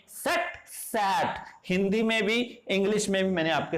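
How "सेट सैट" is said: slow contrast, सेट short e then सैट open ae; set then sat. Shown as a demonstration. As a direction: model the difference slowly. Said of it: "तो ये difference है इसमें".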